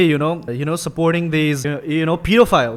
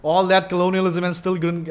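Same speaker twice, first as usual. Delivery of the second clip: normal talking voice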